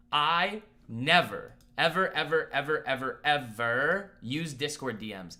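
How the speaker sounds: slow, loud, drawn-out string of evers; emphatic never ever. Real, not acted. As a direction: lean hard on every ever, slowly. Insisting he never uses DMs.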